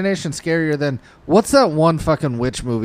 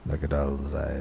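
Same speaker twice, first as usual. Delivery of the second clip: quiet eerie; 10 semitones lower, 11 dB quieter than usual. Retold or imitated. Quoting Quint's eerie line.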